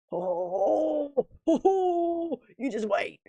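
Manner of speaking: high, laughing "oh-hoo-hoo" of anticipation; gleeful whoop, then a teasing promise; excited. Real, not acted. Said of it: "I'm excited".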